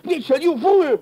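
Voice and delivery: loud, high enraged yell; furious shout. Acted. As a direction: scream it in fury. Villain enraged at captive.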